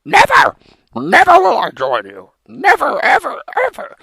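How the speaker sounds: high defiant yelling; defiant shout. Acted. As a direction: refuse with full force. Frog refuses villain defiantly.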